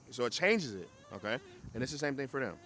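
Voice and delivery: ordinary talk, nothing marked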